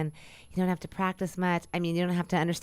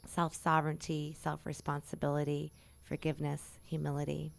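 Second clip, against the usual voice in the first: softer, unhurried blessing to close the session; gentle closing affirmations, softer; affirmation prayer; 4 dB quieter than usual. Acted. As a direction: speak it like a quiet benediction.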